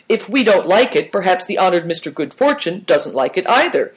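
ordinary delivery

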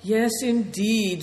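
slow, resigned agreement; sighing. A narrator's reading. The elder regretting he did not send the thief away, sighing. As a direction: let it out on a sigh. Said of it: "sighed Good Fortune".

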